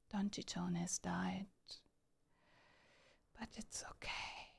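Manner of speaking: hushed whisper, play-by-play; whispered commentary. Real, not acted. Streamer narrating who leads the marble race, whispering. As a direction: whisper the race news to the audience.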